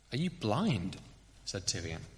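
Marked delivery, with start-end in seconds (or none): none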